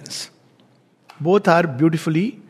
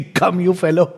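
laughing as you speak; a playful summons breaking into laughter; amused mock command. Imagining the master noting it in his diary, amused.

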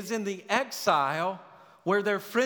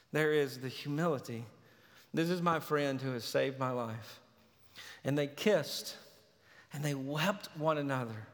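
hushed scripture retelling; 4 semitones lower, 4 dB quieter than usual. Acted. Quiet reflective scripture retelling.

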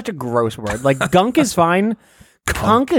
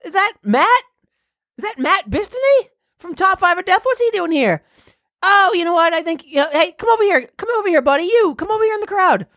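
higher, excited radio announcer, climbing much higher at the floats; parade reporter voice, rising. Acted. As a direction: get more and more worked up pointing at the floats. Continues reporter bit.

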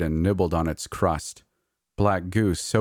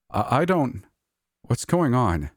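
high, stumbling and startled; stammering; 6 semitones higher than usual. A narrator's reading. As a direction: stammer it out, thrown. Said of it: "I stammered".

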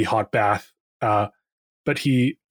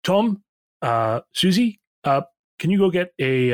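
calling out; 6 semitones higher than usual. Acted. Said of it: "she calls over to a couple of the other nurses".

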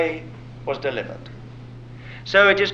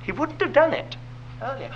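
ordinary talk

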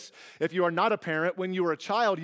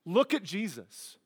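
ordinary delivery

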